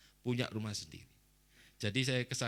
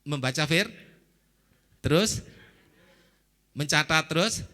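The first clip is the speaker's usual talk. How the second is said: prompting the crowd; 3 semitones higher, 6 dB louder than usual. Real, not acted. Prompting congregation to recall list.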